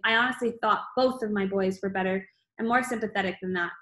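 ordinary delivery, nothing marked

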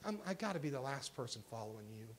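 low, very soft admission; subdued Elijah. Acted. Elijah answering God in the cave, subdued. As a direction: drop low and confess it quietly. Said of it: "Elijah says".

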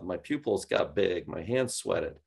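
ordinary talk

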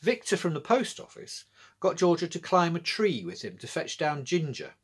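plain reading voice

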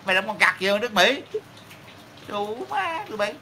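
fast, high tirade; real indignant rant at the audience; angry scolding. Real, not acted. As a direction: tear into them, rushing the words up high. Scolding Vietnamese who talk about America.

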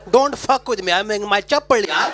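gangster voice. Retold or imitated. Mocking gangsters in chappals.

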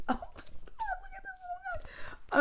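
tiny, very high, soft squeak; squealing coo. Real, not acted. Reader gushing over toy in picture, high soft squeal.

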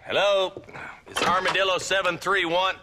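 calling out on a brand new telephone; loud calling. Acted. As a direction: shout into the receiver like you don't trust it.

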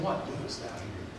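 God's still small voice nagging, soft and low; quiet inner voice. Retold or imitated. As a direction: let it fall to a near whisper. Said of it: "Does he keep saying".

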